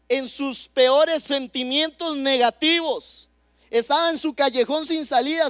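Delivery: ordinary delivery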